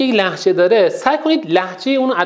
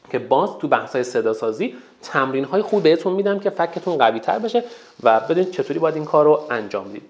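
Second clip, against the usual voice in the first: ordinary talk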